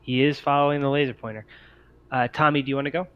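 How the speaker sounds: ordinary talk, nothing marked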